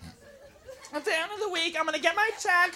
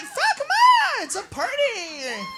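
high, drawn-out party whoop; party cheer; 11 semitones higher, slower than usual. Acted. Women egging each other on at a party, excited cheer. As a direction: cheer them on, voice climbing high.

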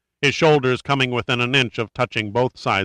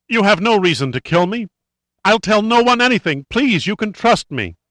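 high, loud desperate plea; terrified begging; 10 semitones higher, 4 dB louder than usual. Acted. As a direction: beg for your life in feigned panic. Captive feigning terror, pleads for life.

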